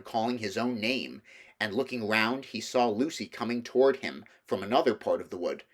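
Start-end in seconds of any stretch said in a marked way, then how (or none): none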